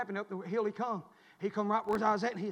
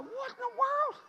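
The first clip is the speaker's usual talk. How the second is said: high, slow "what in the world"; puzzled, high-pitched exclamation; startled disbelief; slower than usual. Retold or imitated. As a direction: draw it out high, in baffled surprise. Startled reaction to limping dog.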